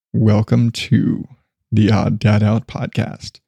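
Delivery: low, very slow, grave radio voice; over dramatic NPR intro. Shown as a demonstration. Host showing his failed NPR-style intro, low and slow. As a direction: sink low and drag each word like public radio. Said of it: "really over dramatic NPR intro".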